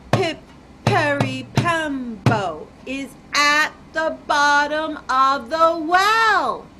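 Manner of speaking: loud, drawn-out, syllable by syllable; very slow. Acted. Chang bows to his mother, speaking very slowly. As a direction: stretch every part of the name out deliberately. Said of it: "slowly, very slowly said".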